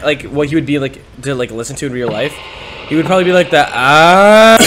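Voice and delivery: ordinary talk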